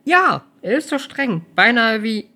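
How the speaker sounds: high, slow and hesitant. Acted. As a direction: trail off unsure. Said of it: "kam es zögerlich".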